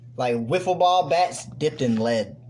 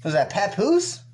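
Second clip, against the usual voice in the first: mocking question; 7 semitones higher, slower than usual. Mocking cohost's headwear.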